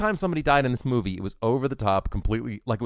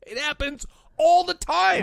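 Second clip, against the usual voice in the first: laughing high exclaim; 4 dB louder, slower than usual. Real, not acted. Laughing about bus supercut.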